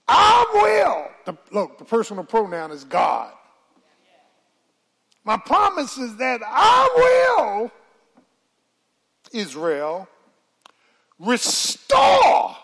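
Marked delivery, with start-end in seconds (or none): 0.1-1.1 high ringing shout of God's promise; preaching shout. Acted. 5.2-7.7 high ringing shout of God's promise; preaching shout. Acted. 11.2-12.6 high ringing shout of God's promise; preaching shout. Acted.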